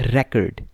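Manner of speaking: Record with the stress on the first part, RE-cord; stress on first syllable. Shown as a demonstration. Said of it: "जब ये as a noun use होगा". (Record पहले हिस्से पर ज़ोर)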